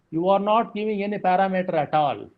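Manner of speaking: ordinary talk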